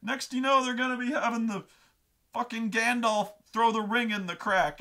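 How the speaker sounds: high, loud, mock-furious refusal; hammed-up angry yelling; red rage. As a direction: imitate a brother ranting in outrage. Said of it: "going into the Red Rage".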